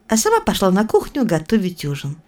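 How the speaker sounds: plain reading voice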